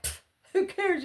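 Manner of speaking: high, airy mock shrug; dismissive. Mom about high school, scoffing carelessly. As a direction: brush it off with a scoff. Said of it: "it was like".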